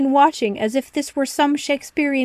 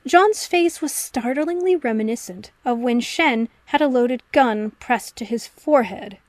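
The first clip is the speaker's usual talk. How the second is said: ordinary delivery, nothing marked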